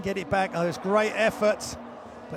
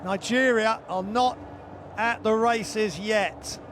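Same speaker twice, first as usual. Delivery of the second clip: excited shouting; 9 semitones higher, 4 dB louder than usual. Real, not acted. Slam dunk in live commentary.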